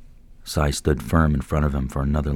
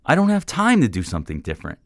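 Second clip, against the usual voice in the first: much higher, louder frustrated protest; heated reply; 9 semitones higher, 5 dB louder, faster than usual. A narrator's reading. Man arguing back at the mentor, heated. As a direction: push back hard in frustration.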